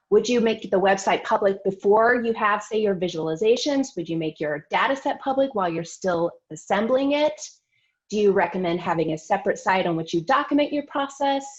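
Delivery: usual speaking voice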